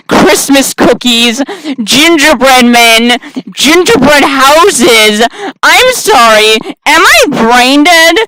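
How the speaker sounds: loud, slow, drawn-out listing; loud, exasperated, rising disbelief; incredulous self-mocking shout. Real, not acted. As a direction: bellow each item at your own stupidity. Incredulous at own obvious mistake.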